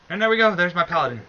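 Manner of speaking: triumphant, loud and high; proud cheer over the finished paladin; excited. Real, not acted. Finished creating his paladin character.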